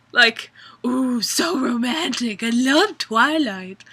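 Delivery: high, loud mock swoon; sarcastic gushing. Real, not acted. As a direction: gush with heavy sarcasm. Sarcastic gushing about Twilight.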